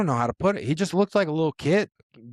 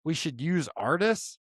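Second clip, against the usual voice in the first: higher, dismissive barking tone; 6 semitones higher than usual. Retold or imitated. Podcaster imitating the UFC boss telling fans off. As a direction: brush off the critics with contempt.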